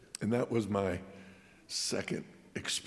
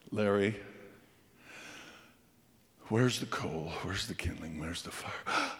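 stern dad, hushed; 3 semitones lower, 4 dB quieter than usual. Retold or imitated. Dad confronting son about chores.

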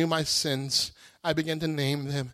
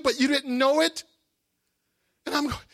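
ordinary talk, nothing marked

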